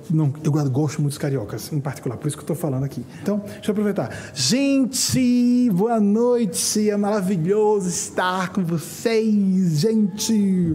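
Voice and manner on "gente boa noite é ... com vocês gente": mock Carioca accent, higher, louder and slow. Speaker greeting the São Paulo audience in Carioca accent. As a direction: ham up a Rio accent with a hissed s. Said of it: "você falar como carioca".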